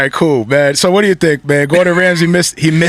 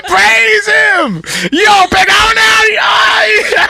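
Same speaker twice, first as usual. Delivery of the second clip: very high, drawn-out praise with laughter; mock ecstatic worship; 10 semitones higher, much slower than usual. Comic praising God for the food, high mock-rapturous cry. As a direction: wail it like a churchgoer overcome with joy.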